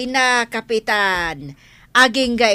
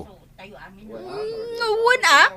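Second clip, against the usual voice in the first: whimper; 12 semitones higher, much slower than usual. Acted. Scolded by captain, whimpers.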